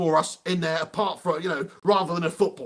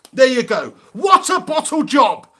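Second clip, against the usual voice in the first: loud scornful jeer; mocking shout; 6 semitones higher, 9 dB louder than usual. Real, not acted. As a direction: jeer at the miss. Mocking a bad miss.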